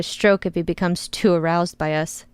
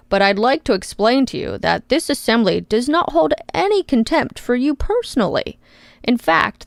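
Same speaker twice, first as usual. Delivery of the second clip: faux empathy; 3 semitones higher than usual. Congressman reassuring the witness with faux empathy. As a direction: fake kindness to put her at ease. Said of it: "continues with faux empathy".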